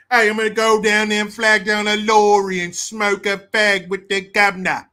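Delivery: loud, hammed-up British accent; mock British accent. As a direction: parody a Brit rattling off slang loudly. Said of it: "It's fun shit".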